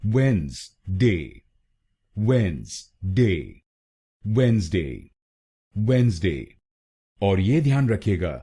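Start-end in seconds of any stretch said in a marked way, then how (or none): 0.0-3.6 Wednesday drawn out slowly, wenz... day; slow, spell-it-out wenz-day for Wednesday; Wednesday stretched out very slowly for learners; slow demo. Shown as a demonstration. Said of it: "तो बनेगा". 4.3-6.6 Wednesday said as wenz-day; Wednesday in two syllables, the D silent; pronunciation demo. Shown as a demonstration. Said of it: "तो बनेगा".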